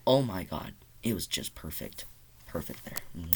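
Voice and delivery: low, soft, fading almost to nothing; hushed reverence. Real, not acted. Fan savouring the post-credit scene, hushed and fading.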